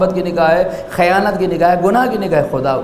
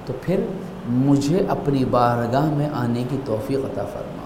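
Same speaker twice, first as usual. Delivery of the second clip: softer and lower, as if confiding to God; hushed intimate prayer; 6 semitones lower, 6 dB quieter than usual. Shown as a demonstration. Preacher to congregation, showing whispered prayer, hushed and low. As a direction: murmur the supplication close and low. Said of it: "بہت ہی دھیمے لہجے میں آپ گفتگو کریں".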